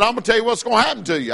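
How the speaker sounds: rapid-fire teacher's warning; teacher warning young Randy; fast warning. Retold or imitated. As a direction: rattle it off fast. Sunday school teacher quoted.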